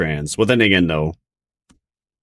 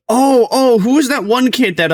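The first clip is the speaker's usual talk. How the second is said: excited; 4 dB louder than usual. Real, not acted. Suddenly remembering.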